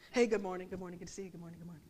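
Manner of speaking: soft, low string of hellos; hushed greetings. Retold or imitated. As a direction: murmur polite greetings under your breath. Greeting people while stepping over them.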